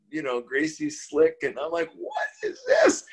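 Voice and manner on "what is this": very high, stretched-out mock cry; shocked disbelief. Rock fan hearing church music, high-pitched shocked disbelief. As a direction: squeal it slowly, baffled and appalled.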